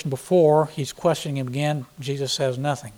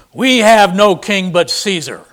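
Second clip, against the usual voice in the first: defiant; 3 semitones higher, 9 dB louder than usual. Acted. Chief priests' reply.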